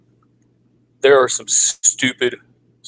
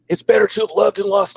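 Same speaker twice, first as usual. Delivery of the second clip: mocking platitude; 10 semitones higher than usual. Mocking a standard platitude reply.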